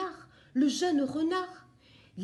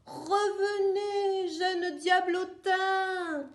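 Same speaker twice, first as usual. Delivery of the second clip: higher, slow drawn-out shout; stretched, pleading yell; crying out; 6 semitones higher, slower than usual. Acted. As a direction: call after the runaway egg at full voice. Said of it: "Elle s'empresse et s'écrie".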